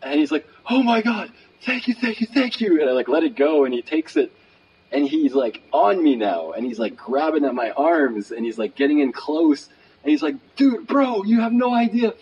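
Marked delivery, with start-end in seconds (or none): 0.7-2.8 high-pitched, gushing imitation; excited gratitude. Imitating a homeless man thanking his benefactor, overexcited gratitude. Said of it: "really excited". 10.6-12.1 high, excited imitation of gratitude; overjoyed. Imitating a happy homeless man getting in close, overjoyed. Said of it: "He's so happy".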